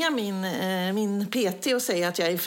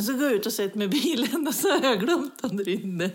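usual speaking voice

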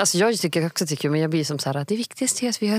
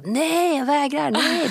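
put-on higher whiny child's no; toddler refusing; 8 semitones higher, much slower than usual. Toddler refusing preschool, higher whiny mock protest. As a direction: play a three-year-old refusing to go.

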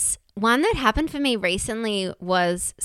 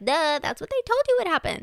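higher, quick teasing duh; mock duh; 9 semitones higher, faster than usual. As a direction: roll your eyes at yourself, fast. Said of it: "And it's like".

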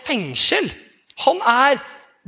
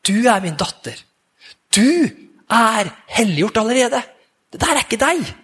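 tender fatherly voice, gently; a hint of a loving father reassuring; warm, loving voice. God telling Kenneth who he is, tenderly. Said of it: "en varm, kjærlighetsfull stemme".

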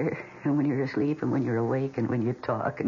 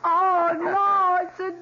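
much higher, louder squeal of joy; delighted squeal; 6 dB louder, much slower than usual. Acted. Delighted shriek at dog.

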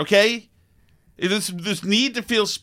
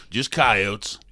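put-on much lower, softer, unbothered old-timer; weathered old camper; 9 semitones lower, 4 dB quieter than usual. Old-timer eating beans dismisses the noise, low and unbothered. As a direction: shrug it off like you've heard it forever. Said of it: "some guy who's been doing it forever ... says".

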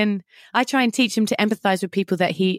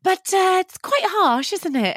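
gentle objection; 9 semitones higher than usual. Retold or imitated. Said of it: "So I'm there going".

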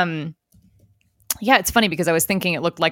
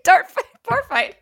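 laughing. Real, not acted. Joking with laughter.

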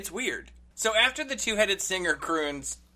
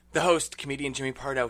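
normal talking voice

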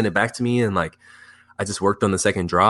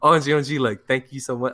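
higher-pitched, starstruck thanks; gushing fanboy; 5 semitones higher than usual. Retold or imitated. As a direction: gush like a thrilled fan. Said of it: "as a fanboy".

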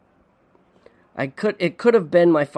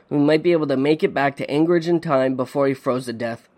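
plain reading voice

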